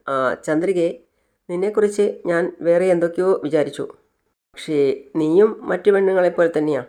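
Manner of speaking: ordinary delivery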